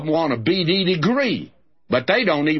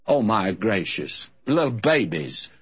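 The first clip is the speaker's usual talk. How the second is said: usual speaking voice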